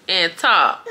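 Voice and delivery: usual speaking voice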